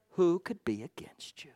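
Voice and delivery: hushed whisper to the church; soft sermon whisper. Real, not acted. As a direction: breathe it almost silently. Sermon drops to a hush.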